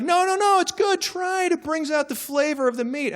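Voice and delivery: high, pushy mock cousin voice. Cousin quoted insisting on food.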